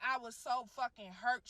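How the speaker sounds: usual speaking voice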